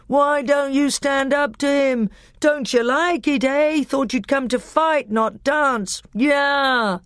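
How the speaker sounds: high-pitched taunting heckle; jeering crowd. A narrator's reading. Telmarines taunting Peter as he dodges, high jeering calls. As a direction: goad the fighter like a booing mob. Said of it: "booed the Telmarines".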